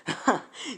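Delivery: a short high burst of toad laughter; hysterical toad laugh. Acted. Giant toad laughing at Naruto, a hammed-up hysterical laugh. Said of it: "starts to laugh hysterically".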